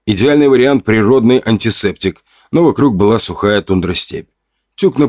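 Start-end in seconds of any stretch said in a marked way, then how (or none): none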